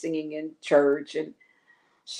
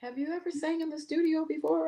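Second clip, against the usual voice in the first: Sly's sister asking; 11 semitones higher than usual. Retold or imitated. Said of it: "then Sly's sister said".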